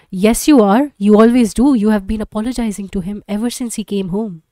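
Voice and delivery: loud, sharp retort at her husband; angry accusation. Acted. Wife snapping at husband in a loud quarrel. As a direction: throw it back at him hard.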